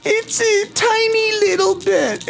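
very high, slow, tiny little voice; tiny squeaky voice. Pretend. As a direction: squeak it out as if you were teeny. Playful tiny voice for a little bell.

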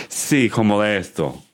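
Castilian Spanish lisp with a laugh; Spanish accent from Spain. Shown as a demonstration. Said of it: "let's hear what you sound like".

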